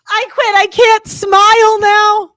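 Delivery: high, loud cry of exasperation; exasperated outburst. Acted. Guest protesting that she can't smile, exasperated.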